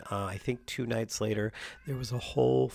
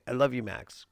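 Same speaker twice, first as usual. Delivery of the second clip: usual speaking voice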